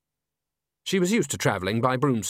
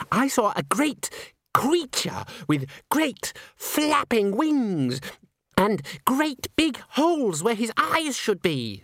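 put-on much higher, slow, dreamy girlish voice; wide-eyed young soprano; 11 semitones higher, slower than usual. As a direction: spin the spooky tale in a high, slow, wondering voice. Christine breathless ghost tale.

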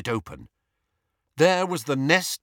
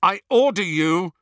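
high, loud command; fierce order; 8 semitones higher, 6 dB louder than usual. A narrator's reading. Harry commanding the elf to speak, fierce. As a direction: press the order hard. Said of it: "said Harry fiercely".

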